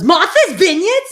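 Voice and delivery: loud, high mock Massachusetts question; strong Massachusetts accent. Host imitating sister-in-law's friend, incredulous Massachusetts accent. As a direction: exclaim it in a thick Massachusetts accent. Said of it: "the way she said it".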